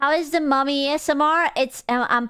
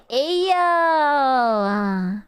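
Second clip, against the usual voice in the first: drawn-out ayo. Acted. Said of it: "I would always say".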